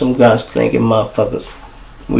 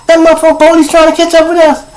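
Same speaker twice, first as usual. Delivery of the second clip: high, loud and fast alarmed question; 4 dB louder, faster than usual. Retold or imitated. Asking the driver about the police car behind, high fast alarm. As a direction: blurt it out in alarm.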